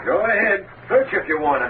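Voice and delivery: high, strained defiance; frightened bravado pitched high; desperate. Acted. Husband daring the gangster's collector to search.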